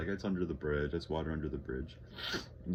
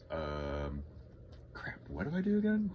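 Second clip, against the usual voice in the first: mock confusion. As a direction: act out blanking at the computer. Acts himself lost at computer.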